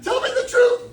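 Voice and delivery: hammed-up jealous wife yelling down the phone; shrieking tell me the truth as the wife; mock angry demand. Wife's jealous accusation on phone.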